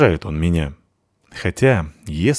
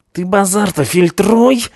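hissed threat, higher and slow; hissing; 12 semitones higher, 4 dB louder, slower than usual. A narrator's reading. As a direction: spit it as a threat. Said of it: "зашипел второй".

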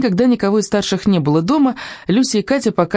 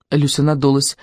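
ordinary delivery

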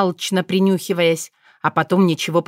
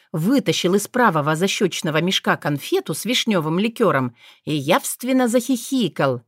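ordinary delivery

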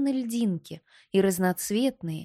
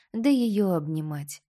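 usual speaking voice

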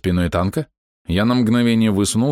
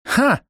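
triumphant ha. Acted. Girl's triumphant laugh.

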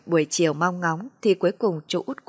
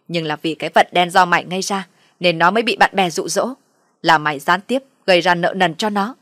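loud, accusing tirade; mother-in-law's irate scolding; angry cursing; 5 dB louder than usual. Acted. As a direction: berate and blame the daughter-in-law. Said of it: "chỉ tay vào mặt tôi mà chửi".

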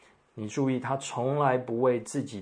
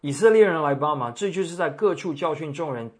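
raised, loud rallying cry from a retold crowd; higher and louder, imitating an accuser's call; crying out for help; 4 semitones higher, 6 dB louder than usual. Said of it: "喊叫说".

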